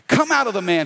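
high, loud order to get out; commanding order. A narrator's reading. Jesus ordering a spirit out of a man, commanding. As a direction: order it out forcefully. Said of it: "he ordered".

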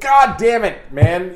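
loud, high cry of exasperation; exasperated outburst. Real, not acted. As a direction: burst out in exasperated protest. Mock outrage at friend not watching show.

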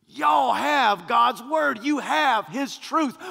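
loud, slow sermon shout; preaching. Real, not acted. Preacher rebuking his congregation, slow and loud. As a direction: thunder it at the congregation.

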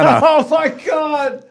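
high, drawn-out pretend gasp of awe; playful overexcited fan reaction; mock thrilled. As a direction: squeal it slowly in hammed-up excitement. Mock thrill at venue name.

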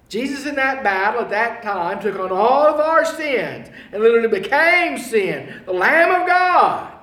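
building loud, high preaching; impassioned preaching. Acted. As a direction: drive it home to the congregation. Preaching climax on battle.

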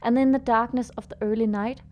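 story narration. A narrator's reading. Reads the story over music.

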